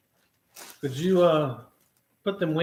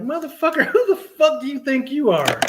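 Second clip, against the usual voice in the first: higher, louder retold cuss-out; retold outburst; 10 semitones higher, 5 dB louder than usual. Retelling how he cussed out his trainer, high and loud. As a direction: fling it at him. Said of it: "I was like".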